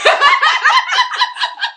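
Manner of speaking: loud high burst of laughter; high squealing laugh, loud. Real, not acted. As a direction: crack up at the friend. Laughing at the eavesdropping.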